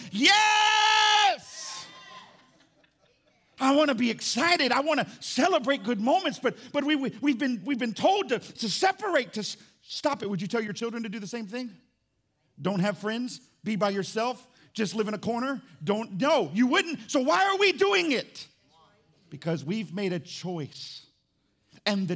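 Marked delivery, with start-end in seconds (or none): 0.1-1.9 huge, high, joyful shout of yes; excited yes. Acted. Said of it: "look at her in the face and go". 3.6-18.5 loud, higher, rising sermon fervor; fiery preaching. Acted. Preacher building to full force.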